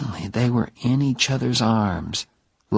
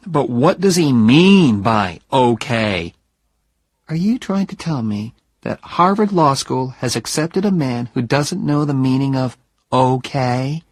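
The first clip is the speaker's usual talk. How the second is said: put-on higher, louder young woman teasing her fiancé; teasing Jenny; 4 semitones higher, 3 dB louder than usual. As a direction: poke fun at him, voice lifted and bright with mischief. Narrator voices Jenny, teasing.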